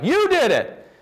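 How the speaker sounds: put-on high, piercing childish accusation; accusing kids. Children blaming Jesus for the death, high accusing cry. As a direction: point the finger, shrill and high. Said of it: "and they're like".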